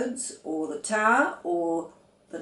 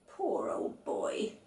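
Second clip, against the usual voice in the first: tender pity; 2 semitones lower, 7 dB quieter, much slower than usual. A narrator's reading. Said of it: "fondling an invisible ear".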